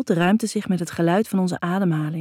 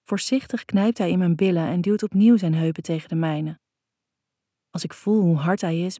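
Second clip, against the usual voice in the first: plain reading voice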